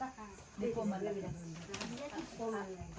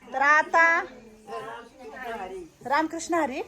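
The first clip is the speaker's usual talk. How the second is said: calling out the greeting, higher and louder; 5 semitones higher, 5 dB louder than usual. Real, not acted. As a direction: call out so the whole room hears. Greeting the elders out loud.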